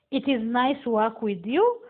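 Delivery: ordinary talk, nothing marked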